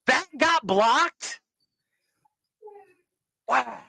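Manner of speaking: stunned disbelief. Real, not acted. Surprised at censored video.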